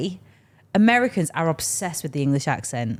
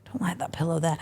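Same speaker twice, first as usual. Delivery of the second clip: soft whispered aside; 6 dB quieter than usual. Real, not acted. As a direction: whisper it to yourself. Unvoiced aside.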